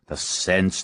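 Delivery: ordinary delivery, nothing marked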